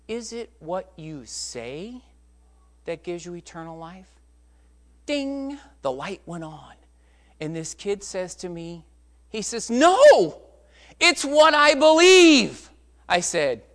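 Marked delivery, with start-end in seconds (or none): 0.1-4.1 soft, measured question; gentle probing. Retold or imitated. Preacher asking the doubting boy what saves, softly. 5.1-5.6 high, held ringing ding; imitated bell ding. Teller as the boy suddenly understands, bell-like ding. 9.7-12.7 high, very loud, drawn-out exclamation; triumphant realization. Retold or imitated. Boy answering preacher once it clicks, exultant shout.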